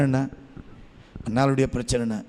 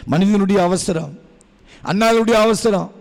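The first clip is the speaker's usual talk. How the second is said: higher, louder preaching voice; 7 semitones higher, 5 dB louder than usual. Acted. Preacher to the congregation, rising loud preaching. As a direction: build the sermon up with force. Sermon at full force.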